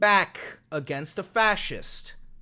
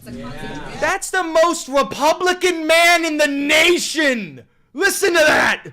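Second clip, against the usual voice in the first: excited hype; 7 semitones higher, 9 dB louder than usual. Real, not acted. Hyping up a clip.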